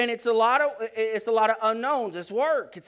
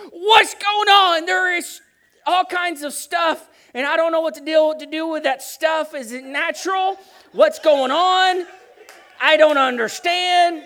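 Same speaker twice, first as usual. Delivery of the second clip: high, loud, slow, bewildered shouting; panicked; 7 semitones higher, 7 dB louder than usual. Retold or imitated. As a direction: call out in a frantic panic over the babies. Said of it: "I'm like".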